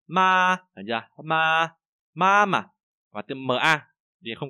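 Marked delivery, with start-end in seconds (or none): 0.1-0.6 high, even, sustained ma; ma drawn out level. Shown as a demonstration. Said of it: "đọc kéo dài hơi ra". 1.2-1.7 long flat-toned ma; ma held level. Shown as a demonstration. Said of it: "đều và ngang ra". 2.1-2.6 high held ma, then short mà; long ma, light mà. Shown as a demonstration. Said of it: "rất là nhẹ nhàng, nhẹ nhàng lướt qua".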